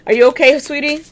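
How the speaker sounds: high-pitched sweet check-in with the dog; cooing to pet. Real, not acted. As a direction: coo a question up high, tenderly. Talking sweetly to her dog.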